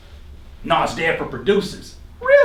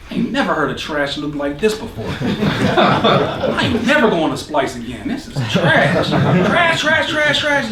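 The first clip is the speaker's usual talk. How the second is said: laughing; slower than usual. Retold or imitated. Inner critic trashing the loop.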